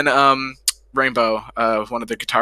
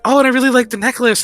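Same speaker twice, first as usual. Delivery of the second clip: much higher, bright gush; gushing compliment; 12 semitones higher, 5 dB louder, faster than usual. Retold or imitated. Chibi praising the necklace, gushing high. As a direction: squeal out the compliment. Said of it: "she talked about, like".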